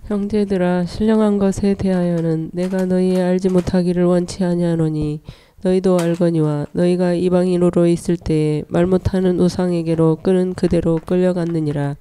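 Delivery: normal talking voice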